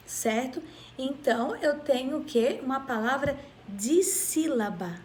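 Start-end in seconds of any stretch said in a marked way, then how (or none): 3.7-5.0 "dissílaba" with di set apart from sílaba; syllable emphasis. Shown as a demonstration. Teacher naming a two-syllable word, "dissílaba" said in parts. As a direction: dwell on the prefix of "dissílaba". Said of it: "porque ela tem duas sílabas".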